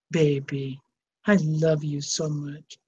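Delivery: low, soft loving murmur; tender to the dog. Real, not acted. Cuddling Sassy on camera, a low soft loving murmur.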